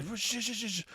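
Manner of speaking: high mock hushing voice; girlfriend hushing. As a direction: squeak out a frantic shush.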